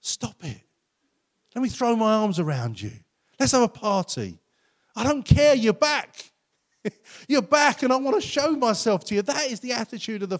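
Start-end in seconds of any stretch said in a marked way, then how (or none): 0.0-0.6 softer, fond hushing; God gently stopping the son's apology; gentle playful hush. Acted. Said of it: "And God's like". 1.5-9.2 God overjoyed the son is back; joyful excitement. Acted. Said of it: "And God's like".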